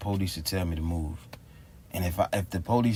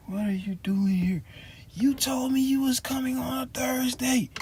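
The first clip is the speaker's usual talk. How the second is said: high, breathless tearful voice; mock crying lady. The crying clerk pleading with him, high and tearful. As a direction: imitate her weeping through the words. She was tears.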